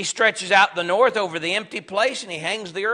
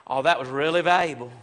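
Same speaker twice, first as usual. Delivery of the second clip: dry, lower mock praise; sarcastic; 5 semitones lower than usual. Job thanking his friend for useless help, dryly sarcastic. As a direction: thank them as if it were worthless. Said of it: "it's like Job saying".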